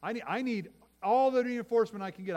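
high, loud pleading call; desperate cry to God. Acted. David crying out to God, high and loud. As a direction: call out for all the help there is.

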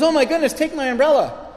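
high, loud gushing concern; concerned girl. Retold or imitated. Young girl offering her umbrella to a soaked stranger, high and gushing. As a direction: squeal it like an alarmed young girl. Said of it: "She goes".

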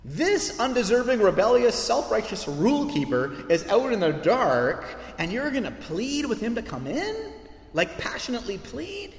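high, incredulous outburst; shocked audience. Retold or imitated. Original audience gasping at the father's pleading, high and incredulous. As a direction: act out the audience's disbelief. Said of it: "The original audience is like".

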